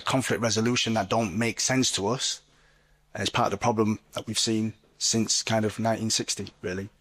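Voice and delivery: usual speaking voice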